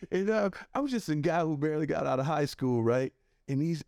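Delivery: ordinary talk, nothing marked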